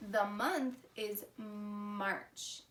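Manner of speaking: usual speaking voice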